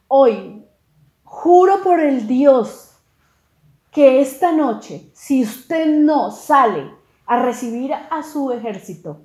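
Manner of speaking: loud, slow, weighty warning; acted stern oath. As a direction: swear it forcefully, drawing out the words. Joab jura y amenaza al rey. (juramento severo, fuerte y pausado)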